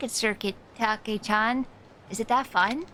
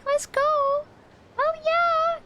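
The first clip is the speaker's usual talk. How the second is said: much higher, bright cheer; excited cheer; much slower than usual. Real, not acted. Streamer cheers a viewer's long sub streak, excited and much higher. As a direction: whoop for a loyal viewer.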